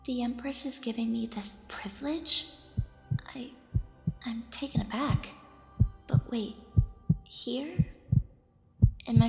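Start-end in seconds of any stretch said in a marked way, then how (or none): 0.1-7.7 hushed astonished whisper. Acted. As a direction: whisper in disbelief. Whispered inner thoughts.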